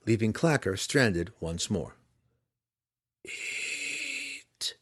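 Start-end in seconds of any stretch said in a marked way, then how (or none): 3.2-4.7 high, distant, quiet command; a far voice ordering inside the head; telepathic command. A narrator's reading. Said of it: "one of the Illithids instructed".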